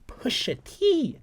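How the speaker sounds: strained very high, slow, voice breaking; voice cracking. Retold or imitated. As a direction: stretch it up until the voice cracks. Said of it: "My voice cracked from that".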